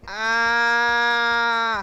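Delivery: flat, drawn-out deadpan ah. Shown as a demonstration. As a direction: say it with zero emotion. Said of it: "in a very, like, deadpan voice".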